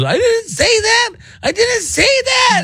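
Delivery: put-on high whiny sobbing kid voice, drawn out; mock-wail the denial like a crying child; imitating crying kid. Kid denies it to his dad, high mock crying wail. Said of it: "He starts crying".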